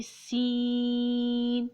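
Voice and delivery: سین held very long with a whistling s; whistling seen. Shown as a demonstration. Teacher demonstrating the letter, long whistling سین. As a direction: draw out سین, hissing like a whistle. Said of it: "دونوں دانتوں کو سختی سے ملائیں گے".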